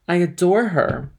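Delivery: loud, slow, fervent declaration; passionate declaration. Acted. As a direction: declare it with all your heart. Lover declares his passion.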